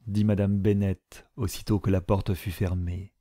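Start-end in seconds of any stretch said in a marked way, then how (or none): none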